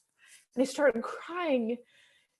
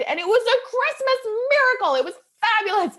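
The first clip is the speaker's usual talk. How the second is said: real excitement; 10 semitones higher, 6 dB louder than usual. Real, not acted. Recounting the Christmas miracle.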